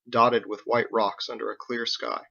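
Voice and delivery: ordinary delivery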